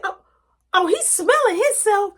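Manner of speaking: high loud scoff and bang; mock outrage, loud and high; angry mom. Mom outraged at the teen locking the door.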